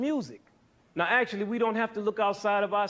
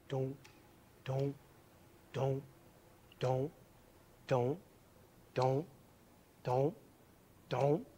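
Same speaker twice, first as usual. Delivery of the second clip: soft, lower, evenly spaced beat syllables; pulse beat imitation; 4 semitones lower, 6 dB quieter, much slower than usual. Shown as a demonstration. Teacher to class, imitating a pulse, soft even beats. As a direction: tap out a heartbeat with the voice, steady and soft. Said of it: "what does our pulse sound like?".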